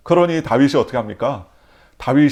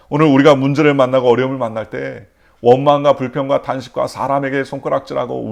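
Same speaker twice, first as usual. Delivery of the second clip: louder preaching push; fervent preaching; 2 semitones higher, 8 dB louder than usual. Acted. Pastor exhorting the congregation, loud and fervent. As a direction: drive it home from the pulpit.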